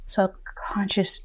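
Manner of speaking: slow, soft whispered repeat; whispered echo. Real, not acted. Host echoing her guest's answer in a slow whisper. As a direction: breathe the word back in disbelief.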